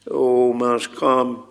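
usual speaking voice

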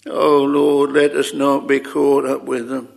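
normal talking voice

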